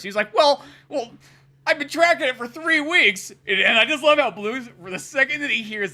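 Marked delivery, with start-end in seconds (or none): 0.3-3.3 put-on high, loud whiny kid voice. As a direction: complain like a kid who got robbed. Kid protests to Blue.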